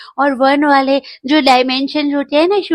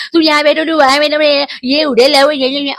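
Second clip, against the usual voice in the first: mock rowdy family; 3 semitones higher than usual. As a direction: imitate the noisy family ordering each other around. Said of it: "इसमें जो उन्होंने हल्ला मचाया". (शोर मचाते परिवार की नकल)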